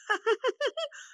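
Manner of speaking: a tiny, very high giggle; squeaky little laugh bursting out; high fairy giggle. Acted. Fairy giggles over surprise.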